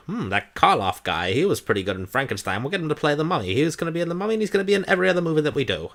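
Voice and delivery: fast-talking exec, pitch climbing high as it goes; badly put-on movie exec rattling off a plan; bad film exec voice. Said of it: "that's a really bad exec voice".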